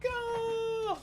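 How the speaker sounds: a sudden high call to the dog; high call to the dog chasing a toy; excited command. Real, not acted. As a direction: fire the dog off after the toy.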